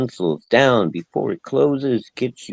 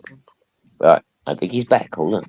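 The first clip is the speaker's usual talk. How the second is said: ordinary talk, nothing marked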